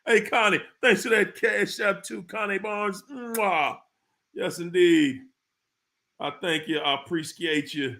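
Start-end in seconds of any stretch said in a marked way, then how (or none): 3.1-3.8 "mwah" kiss noise; blown kiss. Real, not acted. Host thanking Connie for cash, blown kiss. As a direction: smack a quick kiss into the mic.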